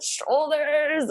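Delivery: high, drawn-out exclamation; mock cry out. Mother imitating herself at the big push, high playful cry. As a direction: call it out high, stretching the word. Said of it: "And I think I said".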